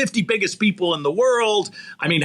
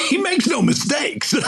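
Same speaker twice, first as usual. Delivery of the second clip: high, laughing through the words. Real, not acted. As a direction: laugh it out, delighted. Laughing affirmation to the coach.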